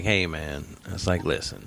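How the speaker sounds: low, soft "hey man" cool dad. Retold or imitated. Dad trying to bond with his son, low and soft. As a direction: ease in like a chill dad. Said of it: "trying to have that cool dad".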